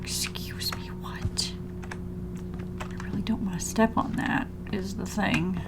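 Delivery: tense hushed whisper. Real, not acted. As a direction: whisper it, nervous and careful. Creeping through scary game area.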